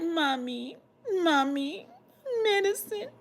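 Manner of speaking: very soft, pleading whimper; imitated baby-like crying. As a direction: whimper weakly for help, as a retold act. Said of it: "I was crying like a baby".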